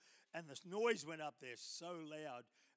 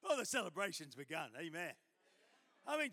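normal talking voice

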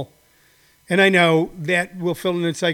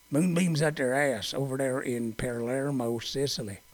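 low, much softer mocking mumble, slowed; mock sneering voice; 6 semitones lower, 11 dB quieter than usual. Mocking the moonbeam claims in a low soft sneer. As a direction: sneer it under your breath.

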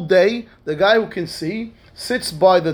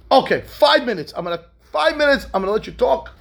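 higher, emphatic giving-in; grudging concession; 7 semitones higher than usual. Retold or imitated. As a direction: give in with impatient emphasis. Patient grudgingly gives in.

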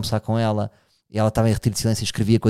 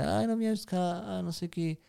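mock nagging parent; 9 dB quieter than usual. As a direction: whine the complaints like a guilt-tripping parent. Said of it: "Eu não quero dizer".

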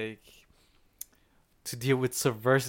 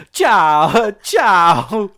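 louder, higher, sing-song ciao ciao; a hammed-up, airy Italian farewell; breezy Italian goodbye; 5 semitones higher, 12 dB louder than usual. Imitating the commissioner's offhand farewell, breezy and loud. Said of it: "No, he just goes".

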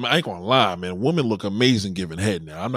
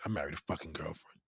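quiet repeat; 7 semitones lower, 15 dB quieter than usual. Acted. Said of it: "repeat quieter".

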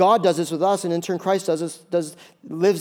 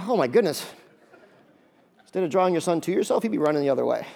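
normal talking voice

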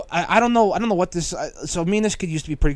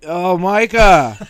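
slow, louder, laughing stoner greeting; stoned stoner drawl; 2 semitones higher, 7 dB louder, much slower than usual. Retold or imitated. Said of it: "Like he's Spicoli".

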